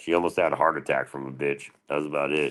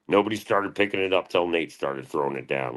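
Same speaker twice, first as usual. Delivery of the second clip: usual speaking voice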